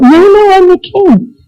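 high-pitched pleading protest; alarmed protest. Acted. King told he will be sacrificed, acted high alarmed protest.

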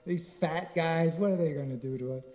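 lightly voiced mocking boys, amused; teasing, lightly laughing dismissal; mocking laughter. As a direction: scoff at the fat wrestlers. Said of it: "And they're laughing".

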